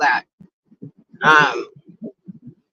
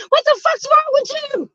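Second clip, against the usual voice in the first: retold yell. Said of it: "I said".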